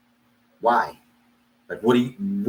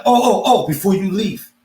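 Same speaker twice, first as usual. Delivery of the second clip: high-pitched, pointed calling back; mock-polite interruption; 7 semitones higher, 3 dB louder than usual. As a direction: stop someone leaving with false politeness. Stops departing landlord, acted again.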